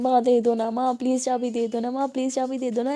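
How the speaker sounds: ordinary delivery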